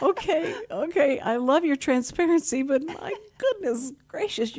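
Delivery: high, laughing, flustered reply. Retold or imitated. Retelling her reply to the blunt friends, high flustered laughing.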